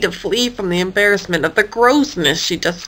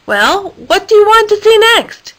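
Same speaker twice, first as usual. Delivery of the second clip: high, pushing question, much higher; impatient ask; 11 semitones higher, 4 dB louder than usual. A narrator's reading. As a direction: press her for an answer. Danielle impatient, asking again.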